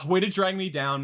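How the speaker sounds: hammed-up sulk; mock hurt. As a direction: play hurt for laughs. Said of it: "I'm joking".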